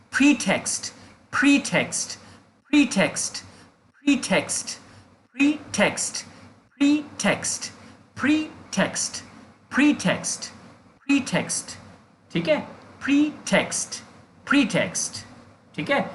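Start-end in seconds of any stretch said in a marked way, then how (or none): 0.1-11.8 "pretext" as PREE-text, high first syllable then a fall; pronunciation drill. Shown as a demonstration. Teacher asking learners to watch the lips, "pretext" as a high PREE, falling text. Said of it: "read my lips". 13.0-15.2 "pretext" as PREE-text, stress first; pronunciation drill. Shown as a demonstration. Teacher telling learners to listen closely, "pretext" with stressed PREE and falling text. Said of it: "मेरे बोलने पे ध्यान दीजिए".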